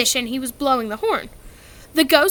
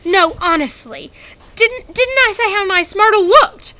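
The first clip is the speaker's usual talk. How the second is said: urgent reassurance; 8 semitones higher, 7 dB louder than usual. A narrator's reading. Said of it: "said Hermione".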